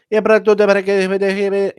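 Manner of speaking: high, garbled mock Portuguese English; Portuguese-sounding accent. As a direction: babble slowly in a put-on Portuguese accent. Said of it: "he sounds like a Portuguese guy".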